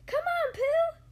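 high, loud little Roo voice; squeaky-high, eager call; excited cry. A narrator's reading. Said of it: "cried Roo".

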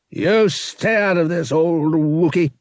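loud, high, harsh villain snapping; harsh snap. Acted. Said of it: "he snapped in a voice nearly as harsh".

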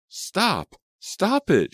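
high, loud desperate scream. A narrator's reading. Piercing plea to stop. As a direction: Selena trying to stop the fight, screaming. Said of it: "she screamed".

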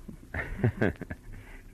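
short breathy chuckle; a quiet, amused little laugh; soft laugh. Acted. Detective reacts to client's photo.

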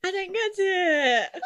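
giggling, drawn out; laughing. Real, not acted. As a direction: laugh through the words. Laughing confusion.

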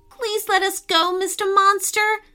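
high, small begging voice; pleading girl voice. Acted. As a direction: plead with the monster.

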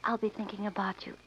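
soft, breath-light murmur; tender good night. Acted. Woman parts from her sweetheart at night, tender murmur.

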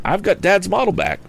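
put-on high, jubilant young voice; crying out. A narrator's reading. Tom seizes the box, high jubilant cry. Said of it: "he cried".

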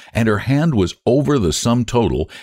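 plain reading voice

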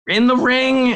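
high excited disbelief; surprised. Retold or imitated. Retelling shock at wrestling show.

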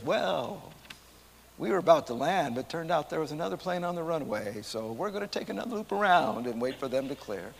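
lightly voiced airline pilot announcement. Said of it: "in his pilot voice".

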